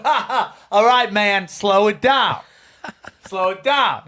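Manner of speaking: laughing cop letting them off; amused cop. Retold or imitated. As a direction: chuckle through the warning, imitating a cop.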